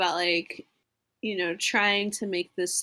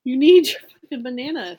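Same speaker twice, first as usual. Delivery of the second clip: louder, punching need; emphatic swearing echo; 3 semitones higher, 7 dB louder than usual. Acted. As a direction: insist hard, swearing for effect. Echoes banana line louder.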